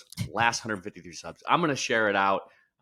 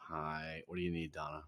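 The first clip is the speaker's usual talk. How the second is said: soft, low, weary phone answer; barely bothered to pick up; tired phone voice; 7 semitones lower, 13 dB quieter than usual. Shown as a demonstration. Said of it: "say hello the way that you say hello when I call you".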